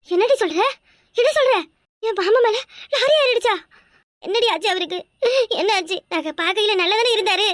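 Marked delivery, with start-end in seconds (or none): 0.1-3.5 shocked disbelief. Acted. Wife hearing of husband's lorry accident, shocked and alarmed. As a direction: voice jumps high in alarm.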